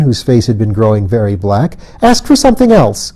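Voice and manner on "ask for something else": sharply higher angry queen; angry cry. A narrator's reading. Fairy queen cries out at Colin, higher and angry. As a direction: snap the order out, higher. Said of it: "cried the Queen".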